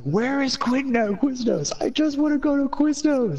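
whiny plea. Retold or imitated. Asking the sign spinner, a high pleading whine. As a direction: beg for Quiznos like a lost child. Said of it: "I'm like".

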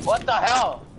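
high, loud exasperated shout; frustrated outburst. Real, not acted. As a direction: vent at losing a fight. Just killed by opponent.

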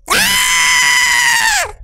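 long high scream; high startled scream. Real, not acted. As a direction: scream as the scare hits. Wakes from nightmare scene.

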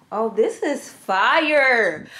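slow, drawn-out praise of fire; slow admiring. Real, not acted. Showing viewers her top, slow admiring drawl. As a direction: linger on fire.

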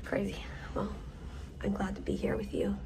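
soft hushed whisper. Real, not acted. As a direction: whisper it close and quiet. Whispering in the waiting room.

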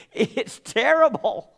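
choked-up slow cry of despair; tearful. Retold or imitated. Pastor retelling breaking down to his friend, tearful. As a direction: break down in tears, slowly. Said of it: "with tears going to my cheeks".

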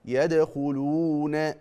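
يدخلون with the waw held two counts; slow correct يدخلون; two-count madd. Shown as a demonstration. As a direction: lengthen the waw of يدخلون evenly. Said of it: "شوف بقرأها إزاي".

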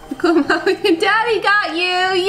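high, sing-song delight. Real, not acted. Parent shows pet son his new truck, excited baby talk.

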